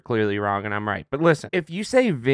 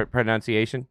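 ordinary delivery

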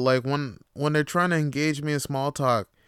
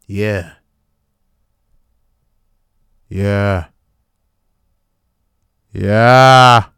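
building from close to right on the mic, louder each time; 6 semitones lower, 13 dB louder, much slower than usual. Shown as a demonstration. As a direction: lean in closer to the mic with every yeah. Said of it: "I'm moving closer to my mic".

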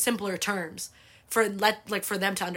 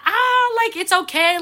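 put-on much higher, louder old grandma voice; grandma brushing it off; 10 semitones higher, 7 dB louder than usual. Grandma telling granddaughter the broken ladder is fine, high dismissive voice. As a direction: wave away the worry, up high and loud.

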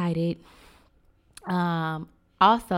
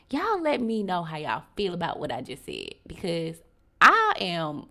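ordinary talk

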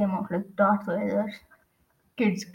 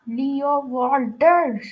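loud, drawn-out raised call; coach's yell; 5 semitones higher, 6 dB louder, much slower than usual. A narrator's reading. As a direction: yell a pupil's name down the bus, stretched out. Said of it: "Coach Hedge yelled from the front".

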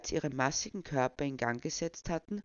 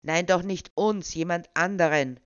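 louder, insistent correction; 3 semitones higher, 8 dB louder than usual. Acted. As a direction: set him straight impatiently. Pushy official corrects old man.

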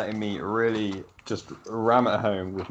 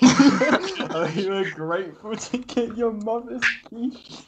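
laughing tease; 10 semitones higher, 3 dB louder, much slower than usual. Real, not acted. Teasing friend, laughing.

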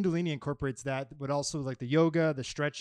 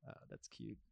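whispered aside; 4 semitones lower, 18 dB quieter than usual. Retold or imitated.

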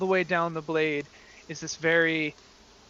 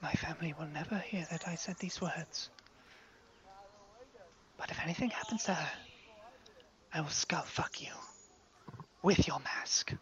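soft, hissed threat; mother whispering a vicious threat; menacing whisper; 11 dB quieter than usual. Acted. Mother threatens into your face.

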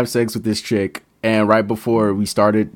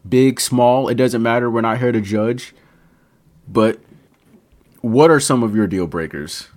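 ordinary delivery

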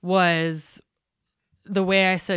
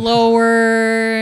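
"slower" loud and stretched right out; slow drawl; 3 semitones higher, 8 dB louder than usual. Shown as a demonstration. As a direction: draw out "slower" to act out the slowness. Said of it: "they speak slower".

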